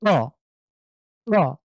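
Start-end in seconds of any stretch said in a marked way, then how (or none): none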